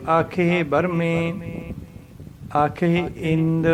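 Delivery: slow recitation, a clear pause after ਬਰਮੇ; drawn-out ਆਖੇ, then stop on ਬਰਮੇ before ਆਖੇ ਇੰਦ; paced scripture reading resting at ਬਰਮੇ. Shown as a demonstration. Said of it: "ਇਸ ਤਰ੍ਹਾਂ ਬੋਲਣਾ".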